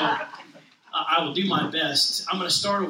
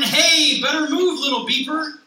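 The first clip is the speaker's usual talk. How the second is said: higher, louder mock holler; hollering sweeper; 7 semitones higher, 8 dB louder than usual. Big street sweeper hollering at Blue, mock holler. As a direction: yell it out the window.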